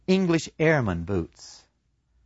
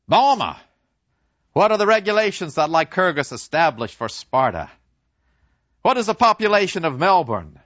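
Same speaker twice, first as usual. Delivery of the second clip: high, louder mock drill; mock teacher quiz; 6 semitones higher, 4 dB louder than usual. Narrator mocking the professor's useless quizzing, high. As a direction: parody the schoolroom questions.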